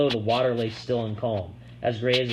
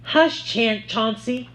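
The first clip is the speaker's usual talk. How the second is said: woman's voice; 11 semitones higher, 4 dB louder, much slower than usual. A narrator's reading. Woman hushes dog.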